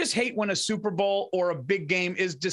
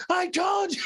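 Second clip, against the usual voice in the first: much higher, triumphant; gloating; 11 semitones higher than usual. Retold or imitated. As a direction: crow it like you called it. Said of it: "he's going".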